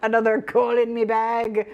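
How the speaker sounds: hammed-up, badly faked Scottish; bad Scottish accent. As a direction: put on a silly Scottish accent. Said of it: "nation of Scotland".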